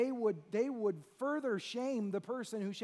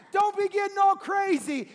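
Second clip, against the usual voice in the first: louder, higher scolding in a put-on voice; mocking crowd; 6 semitones higher, 7 dB louder than usual. Crowd scolding the shouting beggar, loud put-on voice. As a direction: tell him to calm down, mockingly.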